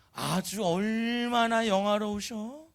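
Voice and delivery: mock admiration with very drawn-out vowels; sarcastic sneer. Shown as a demonstration. Wife mocking her dancing husband, sarcastically. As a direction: stretch it slowly into biting false praise. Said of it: "이렇게 비꼬는 건 아닌지".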